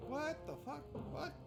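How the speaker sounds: usual speaking voice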